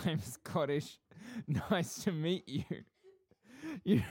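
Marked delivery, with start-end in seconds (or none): 0.1-2.6 higher-pitched mock quote of a Scottish character; mocking Scottish imitation. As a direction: tease the would-be Scotsman's lines. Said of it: "all you've said is".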